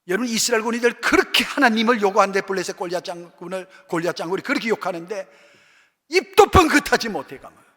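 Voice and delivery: preacher building to a climax before the church; fiery preaching. Acted. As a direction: pound the point home from the pulpit. Preaching at full force.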